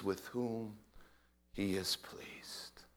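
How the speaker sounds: slow whisper trailing to nothing; fading whisper. Acted. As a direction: let the verse die into a whisper. Quoted angels fade out.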